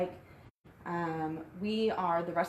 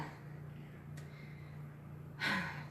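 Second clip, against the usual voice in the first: breathy sigh; 12 dB quieter than usual. Real, not acted. Winded during livestream.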